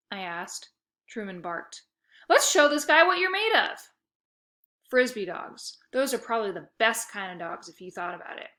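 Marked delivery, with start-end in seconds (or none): 2.3-3.9 higher and louder, pumped up; excited call to dog. A narrator's reading. Boy psyching up the border collie before the frisbee throw, excited.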